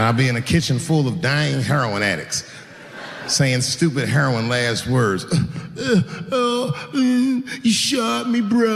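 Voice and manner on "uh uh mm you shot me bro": high, groaning stunned junkie voice; dazed shot addict. Pretend. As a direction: sound hurt and confused. Dying addict's last words.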